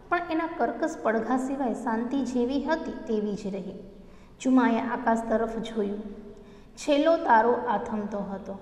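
normal talking voice

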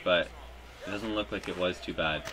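ordinary delivery

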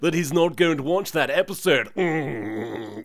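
nasal-free mock know-it-all drone; lightly mocked Bastion. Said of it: "Bastion's like".

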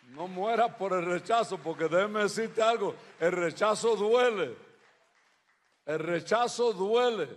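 loud, forceful preacher's delivery; emphatic preaching. Real, not acted. Preacher urging listeners not to die from rejection, emphatic preaching. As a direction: press it home loudly, stretching the key line.

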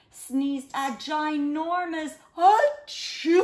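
loud, slow mock sneeze on achoo; big sneeze. Reader to children, hammed-up loud sneeze. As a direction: blow out the achoo like a huge sneeze.

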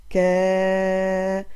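كا held long and loud; long vowel demo. Shown as a demonstration. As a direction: belt out the long aa of كا. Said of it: "صوتها طويل".

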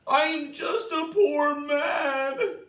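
high, slow whimpering plea; whining. Acted. The caught leprechaun pleading with Tom, high slow whine. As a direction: whine pitifully for mercy. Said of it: "wiggled and twisted and whined".

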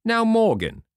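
loud, high stern summons. A narrator's reading. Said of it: "said Long John very sternly".

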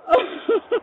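a burst of overwhelmed laughter; emotional laugh. Real, not acted. Overwhelmed by kind gift.

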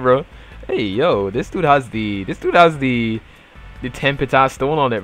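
normal talking voice

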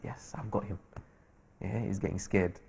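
low, very soft aside; retold private thought. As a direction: think it quietly to yourself. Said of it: "He thinks".

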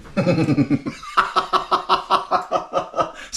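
high, wheezy giggle; long staccato laugh at himself. Real, not acted. Vlogger breaking down over his English, long giggle.

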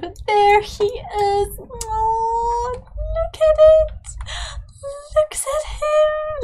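very high, slow, squealing coo; gushing delight. Real, not acted. Gushing over the baby.

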